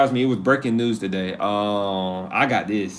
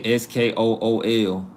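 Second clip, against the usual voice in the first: ordinary talk, nothing marked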